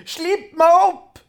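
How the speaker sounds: very high, loud, slow cry; trapped troll begging for release; desperate troll plea. A narrator's reading. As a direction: beg to be let out.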